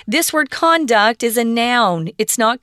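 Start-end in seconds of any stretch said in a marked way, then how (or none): 0.5-1.2 "conduct" with the stress up front, CON-duct; stress on first syllable. Shown as a demonstration. Teacher telling students this word is a noun, first-syllable stress on "conduct". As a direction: hit the first syllable of "conduct", full open o. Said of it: "pronunciation's different".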